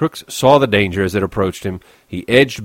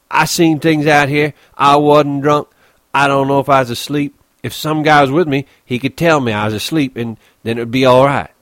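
raised, louder confession; agitated Crooks; 7 semitones higher, 6 dB louder than usual. Acted. Crooks tells what he sees alone at night, agitated. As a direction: press on high and loud, troubled.

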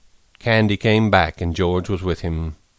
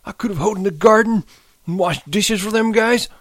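put-on high, tearful old man; sniveling; 13 semitones higher than usual. A narrator's reading. Candy mourns his lost future, sniveling. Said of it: "He sniveled and his voice shook".

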